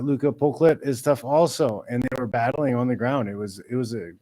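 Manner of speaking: ordinary talk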